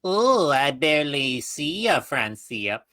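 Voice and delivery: lilting elf lady voice; Francina's voice. Acted. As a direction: play the snooty elf Francina. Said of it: "try and, like, get her voice".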